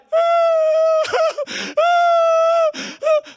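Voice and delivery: high, loud mock wail of a crying child; imitated child wail. Pastor imitating his daughter crying, loud high wail. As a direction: wail like a little girl in tears. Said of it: "she cried".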